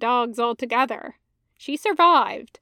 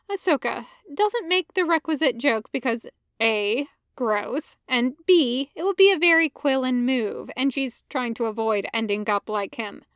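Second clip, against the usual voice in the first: ordinary delivery, nothing marked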